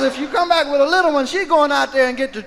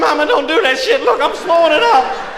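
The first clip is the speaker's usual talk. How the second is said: loud, higher, hurried-sounding protest; mock panicked pleading; 4 semitones higher, 5 dB louder than usual. Grandson caught with cocaine by grandmother, panicked pleading. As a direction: plead loudly, acting alarmed.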